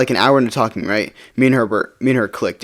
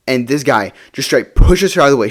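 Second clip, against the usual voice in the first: usual speaking voice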